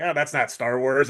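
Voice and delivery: ordinary delivery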